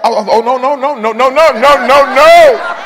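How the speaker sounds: hammed-up panic as the tear comes; mock panic. Preacher acting out crying at a movie, rising to a shout.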